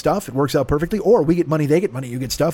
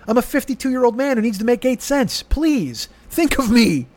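mock pleading; 6 semitones higher than usual. Pleading for Amazon pennies.

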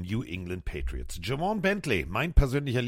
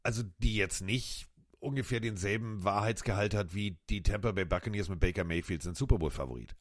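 ordinary talk, nothing marked

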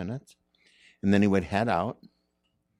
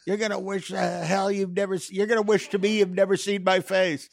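mock threatening voice; 11 semitones higher than usual. Impersonating someone's threat.

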